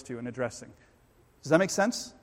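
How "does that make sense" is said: ordinary talk, nothing marked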